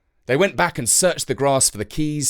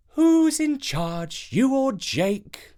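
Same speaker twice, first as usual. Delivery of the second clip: high, slow teasing question; a mother teasing the father over lunch, high and slow; teasing Mum; 12 semitones higher, much slower than usual. A narrator's reading. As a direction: stretch it out, needling him playfully.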